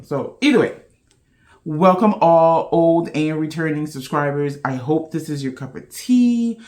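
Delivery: normal talking voice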